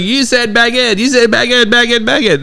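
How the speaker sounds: high-pitched mock accusation, repeated insistently; imitated mad heckler. Comedian mimics mad heckler accusing him, mock anger. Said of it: "And she's mad".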